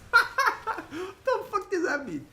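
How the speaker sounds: high squeal of laughing disbelief; cracking up while asking. Real, not acted. Laughing reading a chat message.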